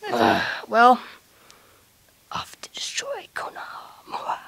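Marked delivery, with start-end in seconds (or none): 2.3-4.4 slow, sinister whisper. Acted. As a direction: whisper a dark plan. Villain aside.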